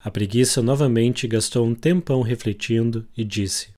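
ordinary delivery, nothing marked

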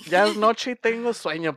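más agudo, quejándose de sueño; súplica alta al profe; Harry insistente. Retold or imitated. (Harry le insiste al profe, agudo y quejumbroso)

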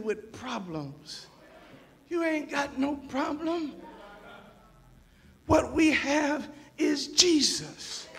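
soft, measured sermon voice; hushed preaching. Real, not acted. Preacher reproving the church, hushed preaching. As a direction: drop right down and let each phrase sit.

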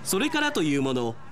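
normal talking voice

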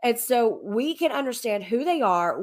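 ordinary talk

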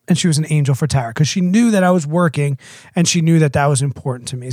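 usual speaking voice

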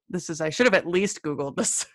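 ordinary delivery

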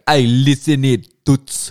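loud, high mock-Italian accent; Italian tough guy. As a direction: call out like a hammed-up Italian hustler. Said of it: "Straight up Italian".

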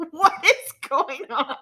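high, loud mock bewilderment through laughter; drawn-out question, cracking up while imitating; retold bewilderment. Speaker imitates mom watching the game, laughing in bewilderment. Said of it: "My mom said she saw me and was like".

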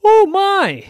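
high, loud shocked squeal; startled bird. Acted. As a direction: squeal in surprise.

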